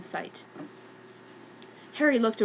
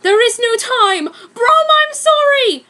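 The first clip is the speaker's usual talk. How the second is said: high, loud crying shout; desperate shout; 13 semitones higher, 11 dB louder than usual. Acted. Harry shouting an apology to his broom. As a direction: plead with a sob in the voice.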